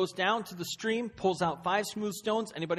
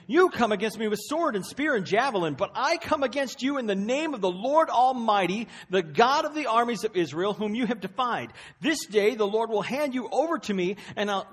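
defiant proclamation; 6 semitones higher, 6 dB louder than usual. Acted. David's defiant speech to Goliath.

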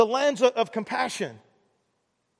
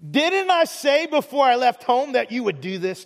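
high-pitched, indignant protest to God; angry Jonah; 5 semitones higher than usual. Acted. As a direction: act out an angry complaint, starting high and easing down. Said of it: "Jonah got very angry at God".

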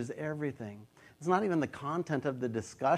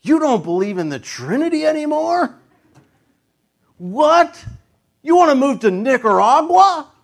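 loud, high, shocked questions; hammed-up disbelief at a spouse; mock outrage over big issues; 13 semitones higher, 15 dB louder than usual. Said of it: "It's never like".

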